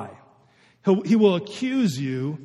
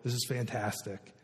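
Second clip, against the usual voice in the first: lower, much softer blissful murmur; 7 semitones lower, 7 dB quieter than usual. Retold or imitated. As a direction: imitate someone lost in contentment, hushed. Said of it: "it's just like".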